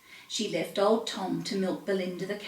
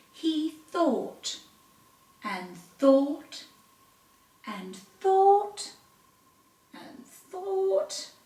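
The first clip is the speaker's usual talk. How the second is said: drawn out; 9 semitones higher, much slower than usual. A narrator's reading. Tom pondering, drawn out.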